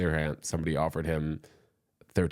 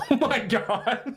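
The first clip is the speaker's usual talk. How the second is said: high, slow laughing disbelief; 4 dB louder, much slower than usual. Real, not acted. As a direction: laugh it out in shock. Shocked laughing reaction.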